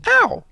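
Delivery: tiny high yelp of pain; high squeaky ow. Acted. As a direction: squeak it like a little bear. Hidden bear sat on.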